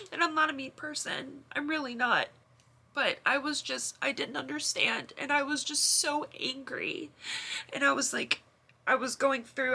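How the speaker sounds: fighting back tears while confessing; tearful. Real, not acted. Sister admitting she was mean to her brother, tearful.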